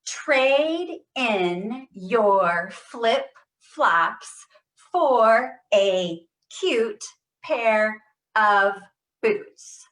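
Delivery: very slow, loud, each word stressed; every word stressed. Shown as a demonstration. Therapist leading the group drill, each word stressed. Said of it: "Every word, give it stress".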